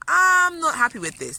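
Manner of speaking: a firm, fed-up declaration; firm outburst. Real, not acted. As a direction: put your foot down hard. Angry at the inner voice.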